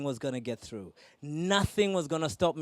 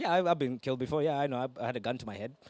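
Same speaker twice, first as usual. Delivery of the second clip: low, offhand imitation of nonchalance; casual shrug; 4 semitones lower, 3 dB quieter, faster than usual. African listeners shrugging at near-death stories, casual. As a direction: toss it off as nothing special. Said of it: "No big deal".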